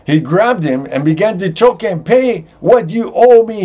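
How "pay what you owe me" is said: higher and louder, a furious creditor; angry demand. A narrator's reading. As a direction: grab him by the collar with your voice. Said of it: "he demanded".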